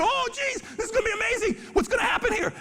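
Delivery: thrilled anticipation of danger; laughing excitement as orcas close in; excited laughing. Retold or imitated.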